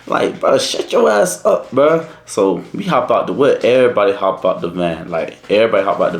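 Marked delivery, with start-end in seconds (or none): 0.4-2.1 high, drawn-out mock scolding; slow, high-pitched comic protest; retold exasperation. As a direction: act out incredulous telling-off, pitched way up. Teller retells snapping at friend.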